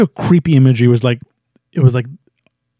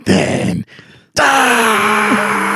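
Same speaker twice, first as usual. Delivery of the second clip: goddess voice; 6 semitones higher than usual. Retold or imitated. The goddess condemning them before she zaps them, menacing wail. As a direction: pronounce a death sentence, stretched out and wailing. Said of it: "And then she goes".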